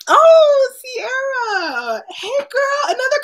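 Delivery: high, delighted shout-laugh calling a friend's name; excited greeting. Real, not acted. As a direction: squeal hello to a friend who just arrived. Greeting a viewer joining the stream.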